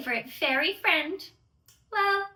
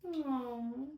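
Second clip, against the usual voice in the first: soft, sad aww; sympathetic aww; 9 dB quieter than usual. Acted. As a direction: coo with pity. Sympathy for the fairies.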